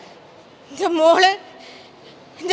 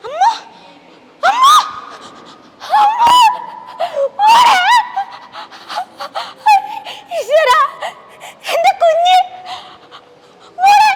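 screaming and sobbing, much higher and louder; anguished scream; 11 semitones higher, 4 dB louder than usual. Acted. Mother finds her hurt daughter, screaming and sobbing. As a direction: wail the child's name in horror.

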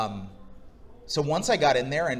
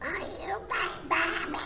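tiny, high, soft squeaky duckling; little duck squeezed into a high cartoon voice; 6 dB quieter than usual. Performer imitating his uncle's Tom and Jerry duck, squeaky duckling. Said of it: "He would do the little duck".

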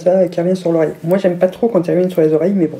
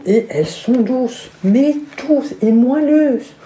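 savouring; 5 semitones higher, slower than usual. Real, not acted. Savouring soft socks.

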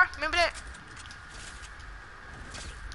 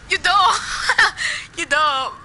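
mocking taunt; 6 semitones higher, 5 dB louder, much slower than usual. Real, not acted. Teasing friend, laughing.

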